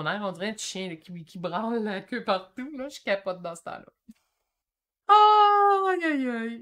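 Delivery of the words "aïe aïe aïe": high, loud drawn-out cry; real worked-up outburst, loud and high; overexcited. Real, not acted. Excited traveller admitting she must calm down, overexcited wail. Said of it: "il faut que je me calme".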